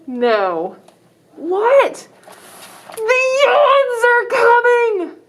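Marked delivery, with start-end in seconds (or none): 1.4-5.2 high, slow alarmed cry; mock panic. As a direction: cry out in hammed-up fear. Kid panics about the yawns.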